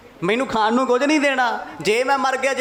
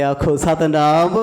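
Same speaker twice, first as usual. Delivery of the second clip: loud call for the sangat to answer Satnam; 4 semitones lower, 5 dB louder, slower than usual. Real, not acted. Preacher calls on the listening congregation, loud rising Satnam.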